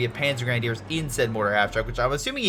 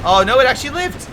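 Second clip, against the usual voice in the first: high, loud burst of surprise; surprised; 9 semitones higher, 8 dB louder than usual. Real, not acted. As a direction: shout it out in disbelief. Surprised a unit survived.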